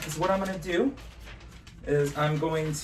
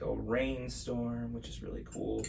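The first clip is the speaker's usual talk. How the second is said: normal talking voice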